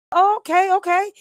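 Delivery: high, startled oh okay; high-pitched mock surprise; surprised oh okay. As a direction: caught off guard, retelling it. Her own reaction to the stranger.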